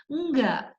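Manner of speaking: usual speaking voice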